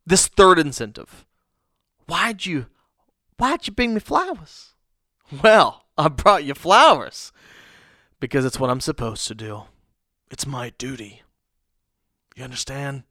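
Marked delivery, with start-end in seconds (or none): 2.1-4.7 higher, softer wife voice; wife quizzing her husband, higher and a bit suspicious. Acted. Wife questions flowers in skit. 5.4-13.0 dutiful husband. Acted. Dutiful husband answers in skit.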